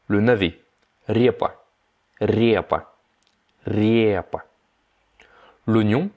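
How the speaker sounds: usual speaking voice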